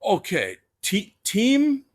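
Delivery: loud, squeaky high rise on Tim; the Sheik, puzzled, in a thick accent; Iron Sheik voice. Retold or imitated. Sheik checking a fan's name, Iranian accent. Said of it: "in his Sheik voice".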